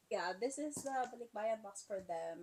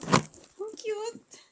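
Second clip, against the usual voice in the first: high, loud burst of delight; real excited cooing; gushing squeal; 11 semitones higher, 11 dB louder than usual. Real, not acted. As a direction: squeal at how cute it is. Gushes over cute gift.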